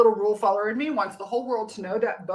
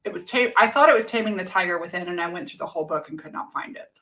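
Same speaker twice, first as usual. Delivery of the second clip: ordinary talk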